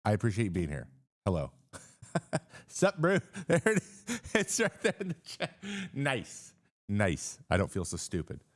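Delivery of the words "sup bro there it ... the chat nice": high-pitched, laughing through the words. Real, not acted. Streamer spotting the joke in chat, laughing. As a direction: crack up, voice shooting way up. Cracks up reading chat's accent joke.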